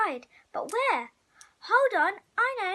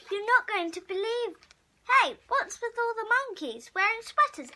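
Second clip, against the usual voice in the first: usual speaking voice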